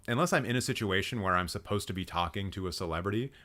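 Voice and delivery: usual speaking voice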